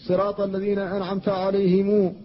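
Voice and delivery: "عليهم" with a long final u, "عليهمُو"; alayhimu reading. Shown as a demonstration. Teacher recites the third reading. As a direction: stretch a u after the م in "عليهم". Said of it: "كلها قراءات".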